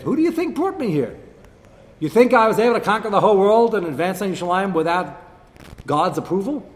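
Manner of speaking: mock challenge. Acted challenge from enemy spokesman.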